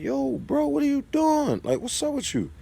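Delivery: sharp, high, incredulous challenge; retelling the moment he caught the neighbor photographing his car, high and incredulous; high-pitched confrontation. Retold or imitated. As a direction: call him out in a high, stunned voice.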